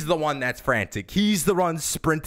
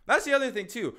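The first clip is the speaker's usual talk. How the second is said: ordinary delivery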